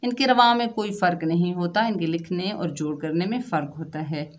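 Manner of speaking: ordinary talk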